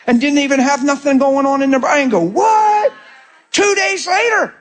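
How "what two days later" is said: imitating someone stunned, drawn out; mock amazement, much higher; astonished. Healed person's astonished reaction retold.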